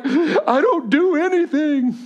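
high, loud mock wail, drawn out; acted breakdown. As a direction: wail it helplessly, re-enacting a panicked meltdown. Acts out his breakdown on knees.

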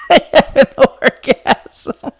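high, slow, loud words through laughter; laughing shout. Real, not acted. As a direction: cry it out while laughing. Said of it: "I'm crying of laughter".

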